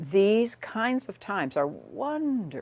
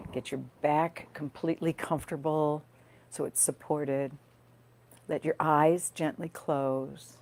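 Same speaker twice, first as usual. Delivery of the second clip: guided meditation. Real, not acted. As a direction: gently guide listeners into their breath, leaving space between phrases.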